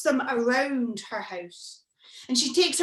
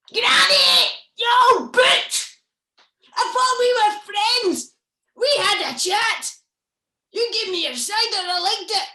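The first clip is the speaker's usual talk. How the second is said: much higher and louder, a monster screaming betrayal; furious monster shout; 9 semitones higher, 8 dB louder than usual. Acted. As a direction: yell at Granny like a betrayed beast. Monster arrives furious at Granny.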